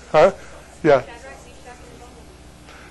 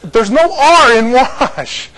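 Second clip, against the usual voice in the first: slow emphatic; 6 semitones higher, 3 dB louder, much slower than usual. Shown as a demonstration. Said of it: "teaching emphasis".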